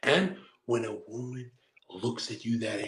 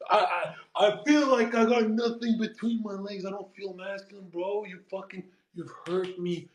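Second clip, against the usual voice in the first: mock wounded; 5 semitones higher than usual. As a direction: plead in a high, wounded voice. Acts out being emasculated and hurt.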